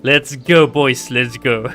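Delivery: ordinary delivery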